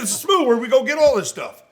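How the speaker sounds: put-on high, matter-of-fact kid voice; lightly voiced young Marine; playful imitation of an innocent young Marine. Marine's reply retold.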